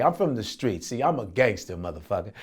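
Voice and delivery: ordinary talk, nothing marked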